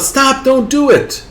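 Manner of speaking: high-pitched cry to stop someone; acted yell. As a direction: yell out as if halting a jumper. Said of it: "And you yell out".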